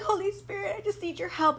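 a retold tearful plea to the Holy Spirit; pleading cry. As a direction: beg for help, near tears. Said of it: "sitting down crying".

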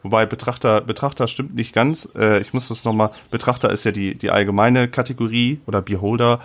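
ordinary talk

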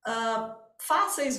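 ordinary talk

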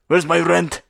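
loud demanding landlord voice; gruff impatient scolding. Retold or imitated. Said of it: "the daughter of".

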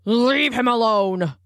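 slow, booming command. A narrator's reading. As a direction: boom it out like a young tiger. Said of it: "boomed a voice".

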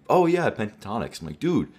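normal talking voice

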